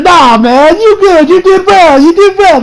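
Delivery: high, loud upbeat buddy voice; cheering him up. Retold or imitated. Krillin cheering Tien up, high and bright. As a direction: pump up a friend who just lost. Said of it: "He's trying to cheer him up".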